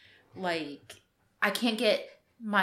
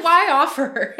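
high, much louder "why offer"; exasperated retort; 9 semitones higher, 14 dB louder than usual. Retold or imitated. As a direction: fling back the retort, exasperated. Retelling her retort laughing.

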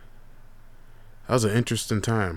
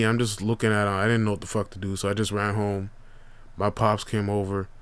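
usual speaking voice

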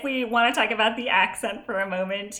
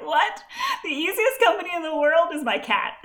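higher and laughing, playful outrage; mock disbelief; 9 semitones higher, 4 dB louder than usual. Aunt joking about the kids line, laughing mock disbelief. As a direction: laugh through an indignant "What?". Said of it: "but I was like".

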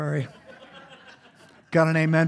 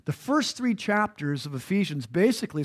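normal talking voice

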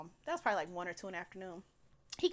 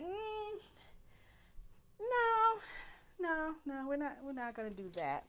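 playful refusal; 8 semitones higher, 4 dB quieter than usual. Retold or imitated. Laughing refusal of scammer.

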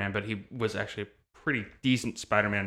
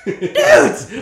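shouting with laughter; 15 dB louder than usual. Retold or imitated. Joining the dude shouting.

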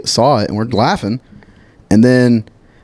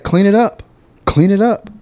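higher, bossy repeated order; mock scolding; 8 semitones higher than usual. Customer ordering narrator to clean up dog mess, higher mock scold. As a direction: imitate a grumpy stranger demanding cleanup. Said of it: "He was like".

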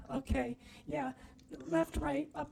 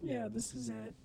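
ordinary talk

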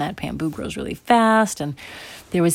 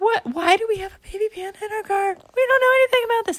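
very high, flustered disbelief; bewildered parents. Acted. Parents driving home with panda, flustered. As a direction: squeak it in mock panic.

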